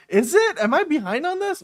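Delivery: high surprised questions; startled disbelief. Real, not acted. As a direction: caught off guard. Surprised at news.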